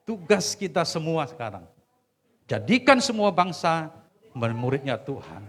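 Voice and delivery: loud, rising exhortation; rally the church to the great commission; preaching at full force. Acted. Preacher closing his sermon, commissioning the church loudly.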